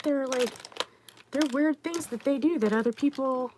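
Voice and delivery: ordinary talk, nothing marked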